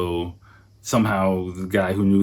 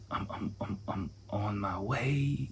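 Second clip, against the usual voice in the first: soft slow whisper to himself; dazed private whisper; whispered thought; 10 dB quieter, slower than usual. Retold or imitated. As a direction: whisper it slowly, half to yourself. Inner thought in a daze.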